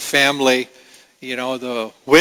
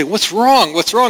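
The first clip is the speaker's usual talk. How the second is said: higher, anxious asking mother; worried mom; 7 semitones higher than usual. Retold or imitated. Mother asking her crying toddler what is wrong, higher anxious voice. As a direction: coax a crying toddler to talk. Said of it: "Mom say".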